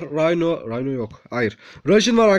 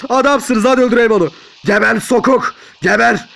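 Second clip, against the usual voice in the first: loud, higher gamer yelling; battle shouting; 6 semitones higher, 6 dB louder than usual. Real, not acted. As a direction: yell it at the enemy you attack. Attacking a game enemy.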